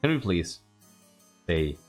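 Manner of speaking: ordinary delivery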